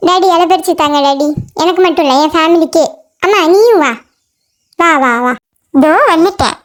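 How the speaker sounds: low-pitched comic figure asking daddy; hungry animal character. Acted. Plays animal asking for food. (சாப்பாடு கேட்கும் தாழ்ந்த குரல்)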